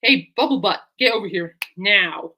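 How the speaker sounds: loud acted call, slowing on the order; mock-stern summons to a dog; commanding. Owner to Bubble Butt who won't listen, commanding.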